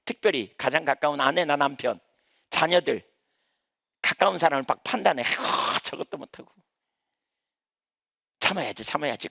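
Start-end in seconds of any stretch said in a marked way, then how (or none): 5.2-9.3 muttered inner grumble. Retold or imitated. Mocking a judging believer.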